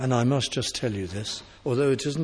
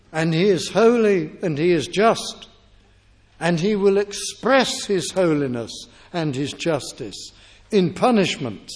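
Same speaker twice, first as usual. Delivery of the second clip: louder, higher pulpit voice; fervent preaching; 4 semitones higher, 4 dB louder than usual. Acted. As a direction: drive the point home to the congregation. Preaching with rising force.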